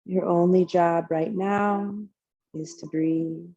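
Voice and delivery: unhurried, calm yoga teacher leading relaxation; guided meditation. Real, not acted. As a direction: guide them gently into the breath, phrase by phrase. Leads a guided breathing meditation.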